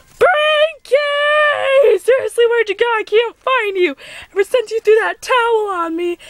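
high, whiny shouting for a lost friend; frantic calling out. Acted. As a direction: call out, exasperated and dramatic.

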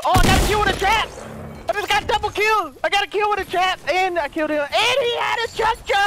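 high-pitched, excited yelling; ecstatic disbelief. Real, not acted. Gamer with a double kill by trap, ecstatic. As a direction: shout the news, thrilled and amazed.

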